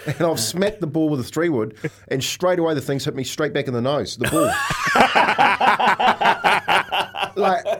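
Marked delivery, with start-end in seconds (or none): 4.6-7.3 big burst of laughter, higher and louder. Real, not acted. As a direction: roar with laughter at the memory. Laughing at own nose story.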